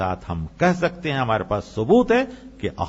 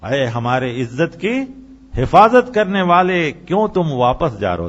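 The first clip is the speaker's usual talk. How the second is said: impassioned crying appeal, building loud; retold weeping plea; 3 semitones higher, 7 dB louder than usual. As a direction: wail the plea of the weeping townsfolk. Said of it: "رو رو کر کہنے لگی".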